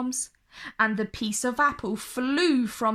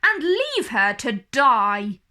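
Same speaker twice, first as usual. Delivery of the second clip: louder, cold and final; queen ordering the huntsman, loud and cruel; 6 dB louder than usual. Acted. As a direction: spit out the death sentence. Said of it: "she snapped".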